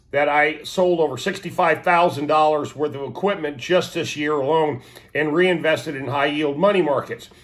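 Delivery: ordinary delivery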